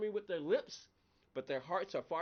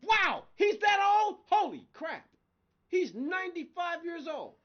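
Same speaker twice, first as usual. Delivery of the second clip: loud, much higher astonished shout; shocked disbelief; 14 semitones higher, 12 dB louder, slower than usual. Real, not acted. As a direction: shout in sheer amazement at his age. Shocked by Duvall's age.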